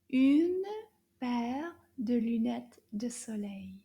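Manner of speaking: Une said loud, then paire de lunettes de soleil in clear French; French model phrase. Shown as a demonstration. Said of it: "we're going to say".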